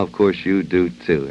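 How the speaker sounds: ordinary talk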